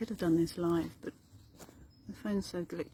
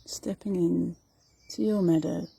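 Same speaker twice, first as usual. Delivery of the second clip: guided meditation; 7 dB louder than usual. Acted. Meditation teacher guiding listeners through meadow and forest, guided meditation. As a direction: lead them unhurriedly through the imagery.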